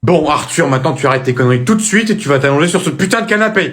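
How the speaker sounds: put-on loud, fast angry rant at the kid; furious shout. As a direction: blow up and bark the order quickly. Médecin perd patience. (le médecin, à bout, hurle sur Arthur: cri furieux et rapide)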